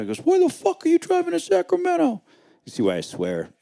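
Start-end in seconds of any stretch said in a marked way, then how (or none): 0.2-2.2 mimicked dad's high-pitched, loud outburst; loud mock-dad tirade, very high; yelling dad. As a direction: yell it as the incredulous dad, voice shooting way up. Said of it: "I was completely yelled at".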